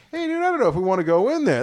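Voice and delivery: high, fast nervous retelling; high hurried imitation of himself; own worry.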